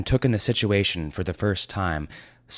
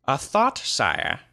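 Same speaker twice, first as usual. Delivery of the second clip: suggesting wizard; 8 semitones higher, slower than usual. Acted. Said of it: "suggested Grand Alf".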